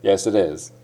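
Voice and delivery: a mock native Yes, it is without any t sound; 1 t dropped. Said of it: "Tって言うてへんやん".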